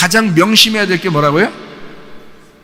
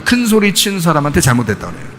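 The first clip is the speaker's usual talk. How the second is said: ordinary talk, nothing marked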